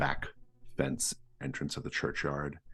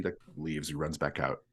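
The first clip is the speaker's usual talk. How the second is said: ordinary talk, nothing marked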